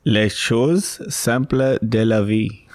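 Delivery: slow French phrase in a so-so accent; ordinary French accent. Shown as a demonstration. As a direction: attempt French slowly with a clumsy accent. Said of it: "a fairly ordinary French accent".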